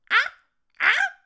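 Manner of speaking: loud, very high shrill "Ah"s; piercing ah. Retold or imitated. Comic imitating the woman's error-message ah, piercing high. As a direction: screech a high piercing ah twice. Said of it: "It's the worst thing in the world".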